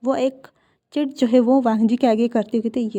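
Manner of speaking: ordinary delivery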